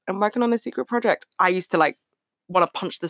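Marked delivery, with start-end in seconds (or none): 0.1-1.3 higher, fast mock YouTuber tease; lightly imitating a YouTuber teasing fans; mock YouTuber teaser. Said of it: "they'd be like".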